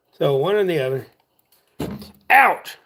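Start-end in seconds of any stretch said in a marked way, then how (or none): 2.3-2.8 short, higher, louder ouch; a sudden pained cry; real pain yelp. Real, not acted. He gets stabbed by something and yelps out loud.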